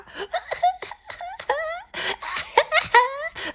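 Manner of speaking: high mock cackling laugh; imitated cackle. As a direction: cackle like the rowdy crowd outside. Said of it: "hollering and laughing".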